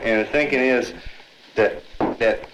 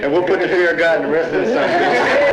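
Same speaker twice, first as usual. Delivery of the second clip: louder and higher, heated and urgent; rally the group, voice raised; fired-up; 5 semitones higher, 3 dB louder than usual. Real, not acted. Young man urging friends to jump a cop, fired-up and loud. Heated call to attack police.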